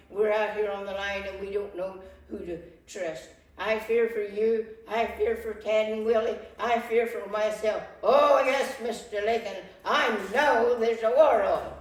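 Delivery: loud, frightened pleading; fearful outburst. Acted. Wife fearing for husband and sons, loud and frantic. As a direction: cry out your fear for the family. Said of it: "please calm down".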